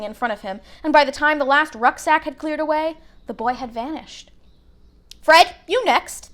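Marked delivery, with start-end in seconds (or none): 5.2-6.3 mother's voice. A narrator's reading. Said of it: "the plump woman said".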